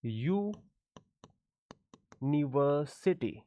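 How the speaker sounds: यूनिवर्सिटी drawn out very slowly, starting with a clear y sound; careful teacher demo of यूनिवर्सिटी with a y sound at the start; slow pronunciation demo. Shown as a demonstration. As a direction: say यूनिवर्सिटी syllable by syllable, yoo-ni-ver-si-ty. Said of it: "इसका pronunciation किस तरीके से निकलता है?".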